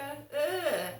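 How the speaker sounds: loud mock groan of disgust. Imitating a bloke jeering as she walks past, mock disgust. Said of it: "you can hear somebody go".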